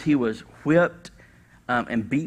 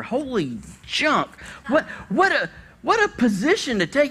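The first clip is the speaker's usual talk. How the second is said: astonished exclamation; 11 semitones higher than usual. Real, not acted.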